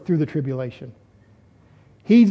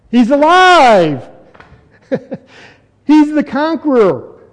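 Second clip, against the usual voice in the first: loud, high, long-drawn "alive"; exultant preaching; 5 semitones higher, 5 dB louder, slower than usual. Real, not acted. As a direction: cry it out in triumph. Preacher exulting at climax of sermon.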